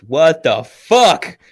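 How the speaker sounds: very loud, high, drawn-out outrage; retold outburst. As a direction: act out a stunned husband exploding. Acted shock at second pregnancy call.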